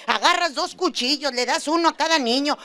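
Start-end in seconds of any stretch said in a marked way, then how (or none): none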